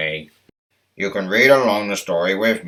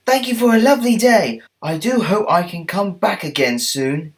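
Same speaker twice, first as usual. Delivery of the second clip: eager little engine thanks; high quick thankful engine; high, fast grateful engine; 10 semitones higher than usual. Acted. Stepney thanks.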